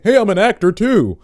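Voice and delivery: loud, high, over-keen announcement; hammed-up pushy newcomer; mock eager introduction. Mimics a pushy would-be actor.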